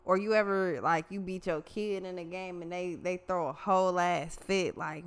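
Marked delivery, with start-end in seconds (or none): none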